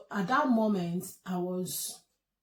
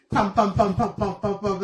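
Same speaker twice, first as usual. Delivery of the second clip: loud rhythmic pam pam beat; leg tapping sound; 2 semitones higher, 10 dB louder than usual. Retold or imitated. Imitating the husband's nervous jiggling leg, loud rhythmic pam. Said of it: "That's how his leg was going".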